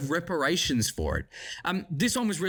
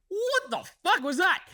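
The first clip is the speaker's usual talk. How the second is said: sudden high startled blurt; jolted by a noise, blurting it out; startled outburst; 14 semitones higher than usual. Real, not acted. Reviewer startled mid-sentence, high startled blurt.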